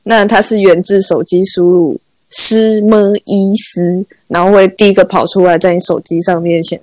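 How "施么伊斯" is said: slow, syllable by syllable "施么伊斯"; spelled out slowly. Shown as a demonstration. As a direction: drag out each syllable of "施么伊斯" like typing it. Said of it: "念得很快就是史密斯". (慢慢一字一字念“施么伊斯”)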